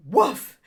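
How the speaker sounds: short loud mock woof; imitated bark. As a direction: bark once like the dog. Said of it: "she just looks at me and she goes".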